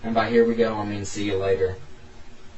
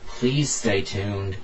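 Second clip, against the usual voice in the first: ordinary delivery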